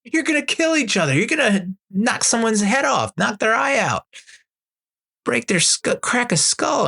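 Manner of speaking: high-pitched panicky dad imitation; alarmed dad. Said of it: "a dad, he goes".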